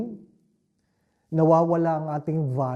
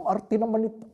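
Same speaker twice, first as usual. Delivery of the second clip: mock dismissive remark; 6 semitones higher, faster than usual. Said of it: "sabi ko".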